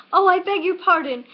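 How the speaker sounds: high, loud, hurried apology; hasty cry. A narrator's reading. Alice apologising again to the bristling mouse, high loud cry. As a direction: blurt out sorry in alarm. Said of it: "cried Alice again".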